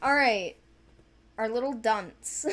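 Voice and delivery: normal talking voice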